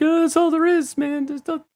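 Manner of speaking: very high, squeaky pleading; robbed shop clerk. Acted. Pawn shop clerk to a yelling robber, high-pitched pleading. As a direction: plead in a thin, high mock-panic voice. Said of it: "one of the robbers is yelling at him".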